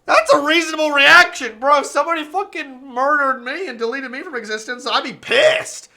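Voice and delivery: loud, emphatic protest; heated argument. Real, not acted. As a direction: insist loudly. Arguing loudly.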